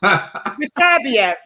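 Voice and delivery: Beterbiev as "beh-TER-bi-ev", drawn out; slow Beterbiev, ending "bi-ev"; British pronunciation. Shown as a demonstration. Co-host showing how we pronounce it, slow "beh-TER-bi-ev". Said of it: "we pronounce it as".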